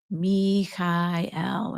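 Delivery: Michael softly intoned as mee-kah-el, slowly, vowel by vowel; emphasized vowel sounds. Shown as a demonstration. Host models what viewers can mentally say, vowels emphasized. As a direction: say Michael as three long syllables, mee-kai-el. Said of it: "just really emphasize the vowel sounds".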